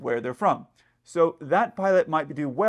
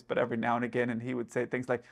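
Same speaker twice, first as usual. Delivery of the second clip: ordinary talk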